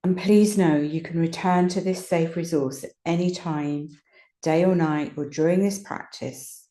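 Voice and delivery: calm guided relaxation with long pauses; unhurried meditation voice, leaving space between phrases. Real, not acted. Teacher settling listeners into rest and ease, guided meditation voice.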